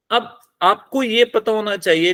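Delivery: usual speaking voice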